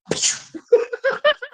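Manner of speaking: high, helpless laugh; giggling fit; real laughter. Real, not acted. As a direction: crack up for a second. Amused by his own retelling.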